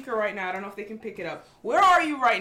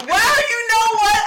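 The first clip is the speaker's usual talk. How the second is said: loud; 11 semitones higher, 11 dB louder than usual. Real, not acted. Mock outrage at caller.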